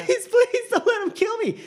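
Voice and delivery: very high squeaky pleading; begging hostage. Acted. Ezra begging the villain not to kill him, high squeal. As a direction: beg like a panicked prisoner.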